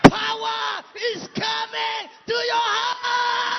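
rising, shouted declarations; preaching building up. Acted.

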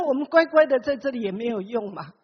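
usual speaking voice

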